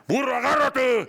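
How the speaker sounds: retold pilot's sudden high, loud shout; pilot yelling. Pilot on the intercom shouts a warning to Burro, loud and high. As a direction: bark the warning at your buddy mid-turbulence. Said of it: "Y oigo que el piloto dice".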